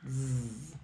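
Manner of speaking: soft low drawn-out "Zzz"; z buzz. Shown as a demonstration. Teacher buzzing "Zzz" after the list. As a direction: hum a gentle buzzing "Zzz".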